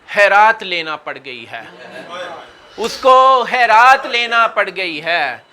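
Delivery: declamatory verse with measured pauses; mushaira ghazal recitation. Acted. Poet presenting ghazal couplets, measured recitation. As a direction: repeat each line with fuller projection, drop on the refrain.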